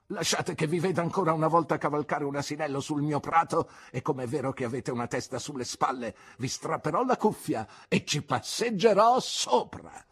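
fast, rising threat, a hint of menace; menacing threat. Aunt threatens Miss Marston over the donkeys, menacing. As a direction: warn her off with quick menace. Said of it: "era così minaccioso".

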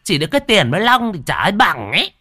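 high, rising sisterly jibe; sharp-tongued scorn. A narrator's reading. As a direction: outdo the sister-in-law's nagging. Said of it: "cũng không kém cạnh".

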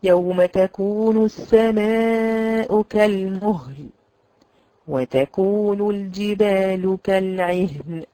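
slow, measured recitation of كالمهل with clear ha; slow recitation example. Shown as a demonstration. Teacher recites the verse slowly as an example. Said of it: "زي مثلًا إيه".

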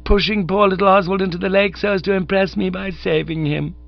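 high, gushing and teasing; hearty young woman's voice. Acted. Honoria teases Bertie about the rescue, high and hearty. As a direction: scold him fondly from above.

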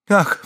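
high-pitched startled "ah"; distressed cry. A narrator's reading. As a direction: let out a sharp cry. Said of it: "вскричала в свою очередь Андреа". (испуганный вскрик)

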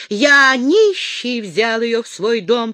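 loud, high, slow grumble; grumbling. A narrator's reading. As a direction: protest indignantly, dragging the words. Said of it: "проворчал таможенник".

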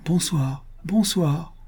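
put-on little girl's goodnight. A narrator's reading. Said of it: "dit la petite en agitant sa menotte".

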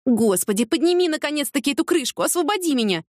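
high-pitched impatient begging; exasperated plea. A narrator's reading. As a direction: plead to be let out at once. Trapped in sofa, pleading. (раздражённая мольба высоким голосом)

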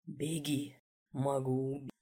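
low, quiet, dark warning; menacing low warning. Acted. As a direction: warn quietly and darkly. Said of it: "мысленно предупредил".

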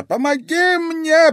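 high, loud, drawn-out plea; old man's desperate cry; groaning plea. A narrator's reading. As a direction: beg for help in a wail. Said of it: "простонал старик".

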